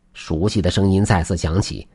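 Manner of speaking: usual speaking voice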